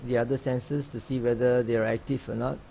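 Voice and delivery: calm, even meditation guide with long pauses; unhurried instructions, leaving long silences between phrases; guided meditation. Real, not acted. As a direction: gently guide sitters into open awareness. Leads a sitting meditation.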